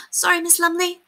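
high, squeaky apology; squeaky housemaid, composing herself. A narrator's reading. Housemaid apologising to governess, squeaky. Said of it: "composing herself".

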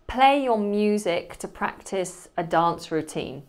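ordinary delivery

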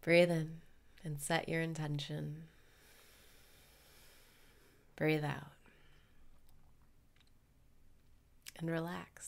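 calm guru voice leading the breathing; guided meditation. Acted.

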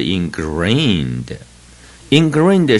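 ingrained said slowly, twice, syllable by syllable; drawn out so students can repeat ingrained; slow model reading. Shown as a demonstration. Teacher reading the new word ingrained slowly to the class. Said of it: "第四个生字是ingrained".

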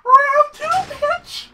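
very high, loud screech; returning bat's voice. Acted. Imagined bat returning for revenge, high screeching taunt. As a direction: screech it as a comeback challenge. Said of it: "It's gonna be like".

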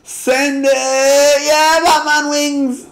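high, loud daredevil cheer; thrilled high-pitched yell; whooping. Real, not acted. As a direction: call it out as you launch into a stunt. Launching off a ramp in game.

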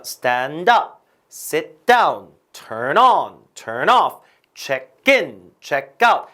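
"up", "down", "on", "off", "in", "out" punched; light verb, then a loud particle like "up" or "out"; stress on second word. Shown as a demonstration. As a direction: drill each pair, hitting "up", "down", "on", "off", "in", "out". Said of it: "구동사 강세는 뒤거든요".

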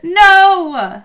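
loud, high cry of dismay; anguished shout. Real, not acted. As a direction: yell out in despair. Sims reject each other.

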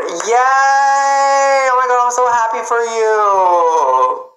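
high loud happy cheer; excited cheer. Real, not acted. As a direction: cheer them on. Cheering a viewer's news.

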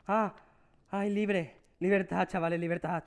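shouting libertad in joy; excited cheer. Real, not acted. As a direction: celebrate breaking free, chavales. Escapes the level, celebrates.

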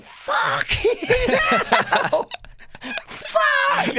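high, shrieking laughing scream; a father screaming in the car at the sight of his son. Retold or imitated. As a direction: shriek it out in shocked disbelief. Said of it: "I'm screaming in the car".

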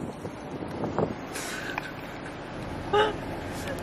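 breathless high-pitched giggling fit; can't stop laughing from embarrassment; hysterical laugh. Real, not acted. Embarrassed after drive-thru order.

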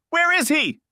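high, loud furious demand; raging. A narrator's reading. Melanie confronting Michael about Frank, furious and loud. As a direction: rage at him. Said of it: "she raged".

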